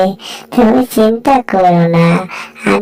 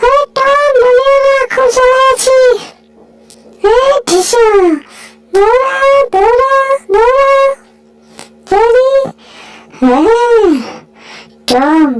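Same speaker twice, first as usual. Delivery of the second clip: high, whiny little mouse complaining; high, fed-up cartoon mouse. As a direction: squeak out the complaints like a harried mouse. Voices Jerry complaining.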